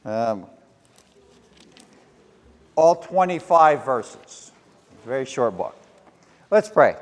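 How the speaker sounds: ordinary talk, nothing marked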